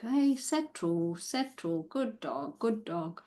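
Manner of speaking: soft, slow settling voice for a dog; gentle soothing. Acted. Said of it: "just a gentle stroke".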